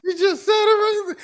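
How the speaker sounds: very high, slow triumphant cry; gleeful gotcha. Real, not acted. Host catching the podcaster out, very high and slow. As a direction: crow it like you caught her.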